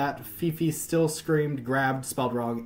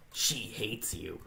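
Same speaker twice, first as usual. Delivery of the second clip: voice in her head; 4 semitones lower, 9 dB quieter, slower than usual. Acted. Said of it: "I keep doing this fucking voice".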